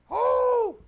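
loud, high drawn-out "oh"; high, loud holler; big exclamation. Real, not acted. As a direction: whoop it out over all you've been through. Exclaiming over storms he has had.

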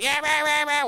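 imitating wife's chatter as rah rah rah; dismissive mock of her complaint; mocking gibberish. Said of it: "whatever she was saying".

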